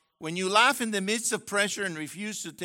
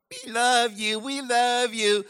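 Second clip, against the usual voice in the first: mock adoring class; 6 semitones higher, 7 dB louder than usual. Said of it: "Go like".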